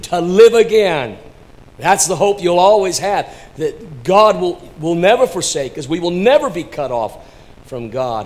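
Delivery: high, loud proclamation of hope; impassioned preaching. Real, not acted. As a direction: ring it out to the whole congregation. Preacher proclaims hope at full force.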